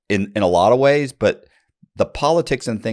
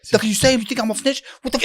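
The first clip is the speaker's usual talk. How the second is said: high-pitched, indignant challenge; imitating angry confrontation; 13 semitones higher, faster than usual. Inmate accusing a man of calling him a snitch, indignant. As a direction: get in his face, pitched way up. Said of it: "right in my face".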